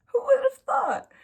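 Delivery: a jokey, high-pitched who-knew; sarcastic mock surprise. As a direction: act shocked at yourself, tongue in cheek. Sarcastic aside about needing therapy.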